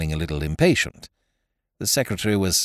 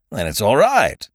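brisk; 6 semitones higher, 7 dB louder than usual. Acted. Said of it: "said Mister Biggs briskly".

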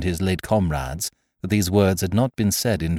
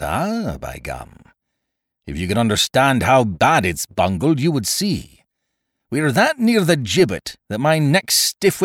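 Silver; 5 semitones higher, 4 dB louder than usual. Acted. Captain warning his crew about the gibbet, scornful and grim. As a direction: warn them grimly with a storyteller's relish.

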